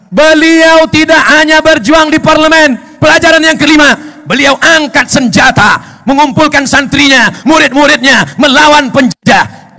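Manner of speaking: loud, high, rousing; rally the crowd with the hero's fight; preacher booming about the armed struggle; fiery preaching. Real, not acted.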